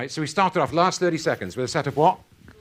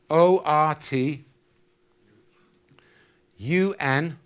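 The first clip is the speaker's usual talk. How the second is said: letters spelled out slowly; much slower than usual. Shown as a demonstration. Speaker spells a puzzle out to the audience, letter by letter, slowly. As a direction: spell each letter slowly with long pauses. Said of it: "Here we go".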